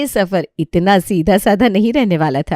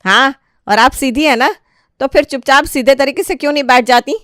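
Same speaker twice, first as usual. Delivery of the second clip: higher, louder sarcastic comeback; sharp retort; 7 semitones higher, 6 dB louder than usual. Acted. Daughter-in-law hitting back at her mother-in-law, sarcastic and loud.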